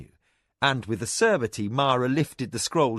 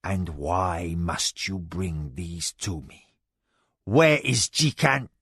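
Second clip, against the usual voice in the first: stern lord; 5 semitones lower, slower than usual. Acted. Darkening lord.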